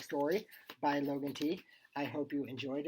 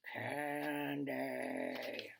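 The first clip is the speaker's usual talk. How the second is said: long, monotone zombie groan; zombie moan; 3 dB quieter than usual. A narrator's reading. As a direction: drag the word out like a hungry zombie. Zombies approaching, getting louder.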